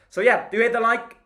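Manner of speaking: loud, fast hype to like the video; hyped plea. Real, not acted. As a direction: pump up the viewers, fast and loud. Pushing viewers to like.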